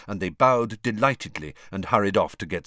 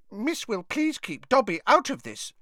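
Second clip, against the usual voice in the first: mumbled; 13 semitones higher than usual. A narrator's reading. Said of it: "Dobby mumbled".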